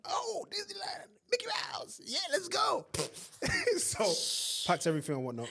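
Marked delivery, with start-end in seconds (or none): 0.1-2.9 put-on high giddy childlike voice; hammed-up high excited child; excited naive kid. Mocking his naive younger self. 2.9-3.7 high giggle. Retold or imitated. Giggle after mock voice.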